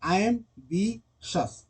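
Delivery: एम बी शस split into slow separate syllables. Shown as a demonstration. Teacher breaking a word into syllables for students, slow and separated. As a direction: spell out एम बी शस slowly, one beat per syllable. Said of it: "यहाँ पे तीन syllable है".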